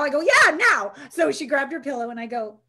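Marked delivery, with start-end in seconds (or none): 0.2-0.9 much higher and louder yeah now; excited coach insisting; emphatic urging. Retold or imitated.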